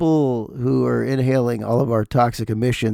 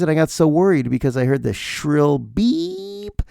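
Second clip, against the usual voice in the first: ordinary talk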